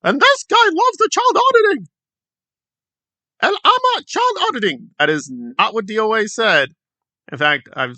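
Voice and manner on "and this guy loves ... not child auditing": high, hammed-up parody voice; mock quote of DOA. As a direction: lampoon the misquote, pitched up. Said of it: "But he said at one point".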